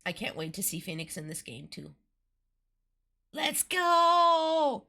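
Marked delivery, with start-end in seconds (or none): 3.3-4.8 high, loud drawn-out cheer; excited cheer. Real, not acted. Cheering as game begins.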